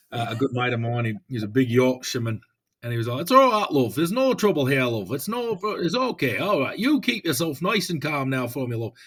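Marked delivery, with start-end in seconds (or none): 3.2-8.9 imitating a big Yorkshireman's Yorkshire accent, higher and louder, soothing "love"; calm someone down in a broad Yorkshire accent. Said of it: "I do apologise for that accent".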